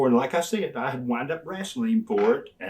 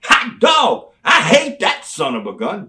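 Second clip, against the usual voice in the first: higher, louder angry fan yell; loud fan reaction; 9 semitones higher, 11 dB louder than usual. Retold or imitated. Texas fans griping about the heel, loud higher yell.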